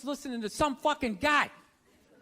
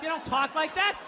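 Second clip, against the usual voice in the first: ordinary delivery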